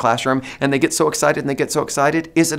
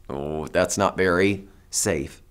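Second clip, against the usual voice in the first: gentle teacher warning; 6 semitones lower, 4 dB quieter, slower than usual. Shown as a demonstration. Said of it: "teacher's gentle correction".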